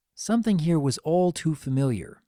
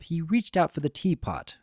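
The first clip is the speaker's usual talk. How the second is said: ordinary delivery, nothing marked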